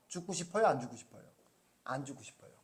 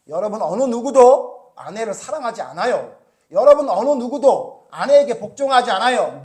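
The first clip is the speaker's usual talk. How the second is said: loud, driving rebuke from the pulpit; preaching at full force; 4 semitones higher, 14 dB louder than usual. Acted. Preacher rebuking his congregation, loud and forceful. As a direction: hammer the point home to the pews.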